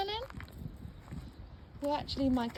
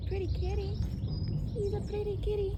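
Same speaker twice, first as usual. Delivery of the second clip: higher cooing praise; pet talk; 9 semitones higher than usual. Real, not acted. Cooing praise to her cat, lifted. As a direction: coo at the pretty kitty.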